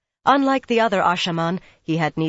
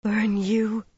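higher-pitched, breathed curse; 5 semitones higher than usual. A narrator's reading. Min answers Rand's talk of the wolf, breathing it. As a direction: let it out on a breath. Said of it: "she breathed".

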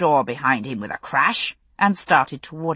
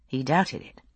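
usual speaking voice

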